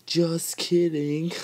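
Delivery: high, loud, slow sing-song; teasing. Pretend. Teasing viewers after faking a wipe, slow high sing-song. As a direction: drag it out to tease the viewers. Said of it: "I fooled you all".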